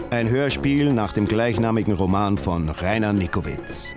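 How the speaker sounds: ordinary talk, nothing marked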